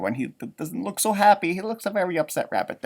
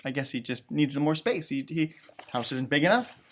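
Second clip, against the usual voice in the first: ordinary delivery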